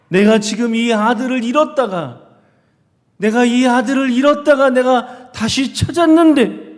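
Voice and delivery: loud, high, impassioned scolding; angry father. Shown as a demonstration. Father berating his eldest son for resenting his brother's return, angry shouting. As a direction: shout it as an outraged father demanding an answer. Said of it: "아버지가 화가 나가지고 하는 걸로".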